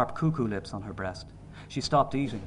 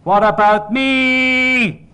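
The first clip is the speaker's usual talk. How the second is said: high, loud, very long-drawn howl; defiant baby's endless wail; all-out scream; 13 semitones higher, 12 dB louder, much slower than usual. A narrator's reading. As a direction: scream it as if life depends on it. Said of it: "I'd scream my life away before I'd let him better me".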